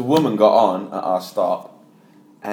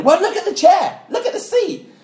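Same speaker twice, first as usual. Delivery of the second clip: way higher, pushy squeal; insistent woman; 5 dB louder than usual. Retold or imitated. Imitating the woman on the wrong train, high and insistent. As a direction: squeak it out like an indignant passenger.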